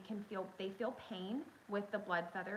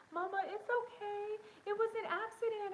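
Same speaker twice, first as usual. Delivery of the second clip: put-on child's comfort; 12 semitones higher than usual. Young son comforting his upset mama, high gentle kid voice. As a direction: soothe mama like a small child. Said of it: "Parker's like".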